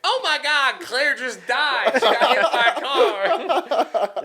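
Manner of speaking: mock tragic news. Fat guy announcing Claire died, slow mock grief with laughter. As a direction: deliver the bad news while cracking up. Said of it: "He's like".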